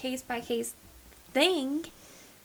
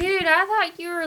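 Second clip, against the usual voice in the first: higher, louder retold protest; dismayed protest; 7 semitones higher, 7 dB louder than usual. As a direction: confront a friend in disbelief. Voicing own dismay at friend.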